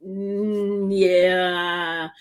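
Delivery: long, drawn-out low "mm, yeah"; hesitant yeah. Pretend. Guest weighing the host's question about the charges, hesitant. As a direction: draw out a reluctant, hypothetical yes.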